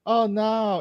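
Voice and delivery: high-pitched mock "oh no"; insincere, put-on alarm; sarcastic fake concern. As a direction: feign dismay you clearly don't feel. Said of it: "I mean that sarcastically".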